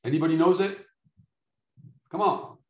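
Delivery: ordinary talk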